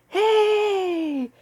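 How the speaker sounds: put-on high excited cry of a boy; a kid bursting out with joy; excited shout. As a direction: leap up with a sudden high yell. Boy jumping up as pirate.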